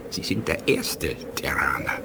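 whispered, almost reverent; a low whispered tribute to his friend; hushed whisper. Acted. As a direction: breathe it out like a solemn honor. Alien elder honors Rhodan, hushed.

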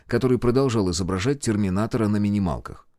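ordinary delivery, nothing marked

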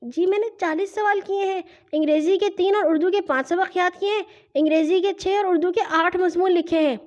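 put-on high-pitched girl's rote report; young sister reciting. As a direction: reel off the list in a high child voice. Sister recites her day's work report. (بچی کی اونچی آواز میں report)

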